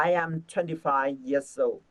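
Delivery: slow, careful words; slow reread. Shown as a demonstration. Teacher rereading the sentence slowly. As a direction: read it again slowly. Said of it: "chúng ta đọc lại một lượt này".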